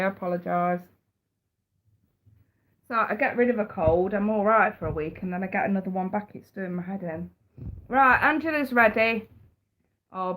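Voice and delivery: usual speaking voice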